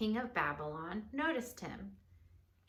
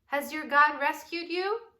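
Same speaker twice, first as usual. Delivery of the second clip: louder, higher anxious call; crying out; 6 semitones higher, 10 dB louder than usual. Acted. King calling into the den for Daniel. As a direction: shout the hopeful question. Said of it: "he cried".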